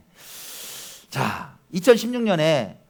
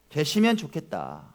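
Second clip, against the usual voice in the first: usual speaking voice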